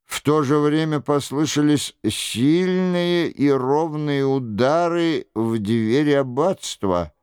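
ordinary delivery, nothing marked

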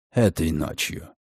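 low, soft, amused male voice; smirking gentleman. Acted. Redfern teases Margaret with a smirk, low amused voice. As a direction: tease her with it. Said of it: "с усмешкой добавил".

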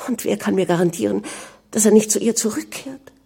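high-pitched inner turmoil, questions rising in agitation; distressed questioning. A narrator's reading. Anna realises who the prince's mistress was, agitated and incredulous. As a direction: think it through in mounting dismay. Anna's shocked thoughts about the mistress.

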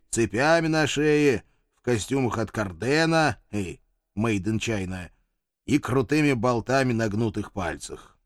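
ordinary delivery

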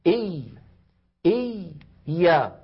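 "إي، يا" with the first ya held before release. Shown as a demonstration. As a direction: sustain the y of "إي", then open into "يا". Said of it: "أتكلم الآن على الياء الأولى". (مدّ الياء الساكنة في "إي" ثم "يا")

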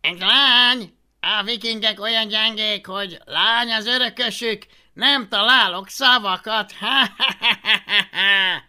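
high, loud scornful laughing; mocking jeer. A narrator's reading. Roman jeering at girl heir.

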